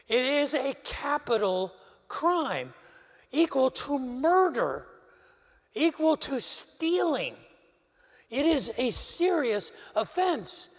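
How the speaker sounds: slow, each phrase set down heavily; slow solemn emphasis. Real, not acted. Preacher slowly stressing how grave adultery is. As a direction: let every phrase land slowly and gravely.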